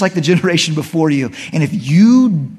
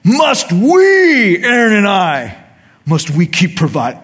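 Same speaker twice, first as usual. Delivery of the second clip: loud, higher scolding that fades off; mock angry Moses; 6 semitones higher, 6 dB louder, slower than usual. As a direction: scold them from the top of your voice. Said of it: "And then he says".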